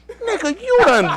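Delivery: very high, insistent questioning; mock interrogator. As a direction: press the accusation in a comic high pitch. Questioner repeats, more insistent.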